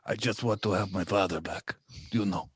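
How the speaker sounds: put-on Spanish accent. Son pleading for his father back, Spanish accent. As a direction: play it as a dramatic Spaniard. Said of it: "I don't know why I'm Spanish now".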